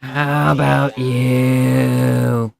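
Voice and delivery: very slow, raspy smoker's voice; chainsmoker voice. Shown as a demonstration. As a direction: drag it out like a lifelong smoker. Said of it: "the goddamn [name] voice".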